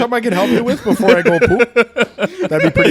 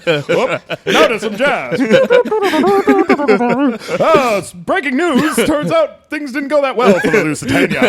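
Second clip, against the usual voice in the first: higher, chipper transatlantic newsreader; old radio announcer; 5 semitones higher than usual. Retold or imitated. Friends mocking old radio, chipper announcer voice. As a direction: read it like a cheery 1910s radio host. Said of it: "the radio voice".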